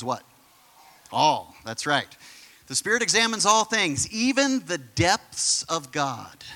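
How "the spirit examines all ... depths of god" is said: emphatic Bible reading, slowing at the end; scripture reading. Real, not acted. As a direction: raise the pitch, then draw the verse out. Reads scripture aloud to congregation.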